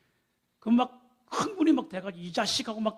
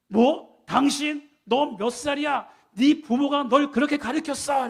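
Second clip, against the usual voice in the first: raised, higher-pitched mock scolding; acted heated outburst; 4 semitones higher, 5 dB louder, slower than usual. Driver quarrelling over who is older, acted angry yelling. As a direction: escalate into an indignant roadside tirade. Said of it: "감정이 격해져서".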